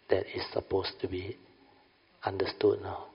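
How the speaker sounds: ordinary delivery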